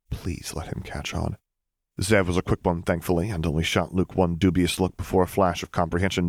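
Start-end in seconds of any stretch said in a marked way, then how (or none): 0.1-1.4 hushed inner plea. A narrator's reading. Luke's silent inner plea.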